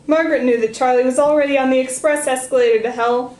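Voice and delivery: usual speaking voice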